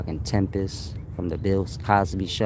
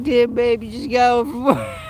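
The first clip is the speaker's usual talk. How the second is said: laughing; 12 semitones higher than usual. Retold or imitated. Mock quote while laughing.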